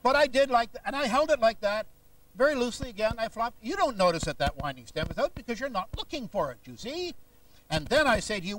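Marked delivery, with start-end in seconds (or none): none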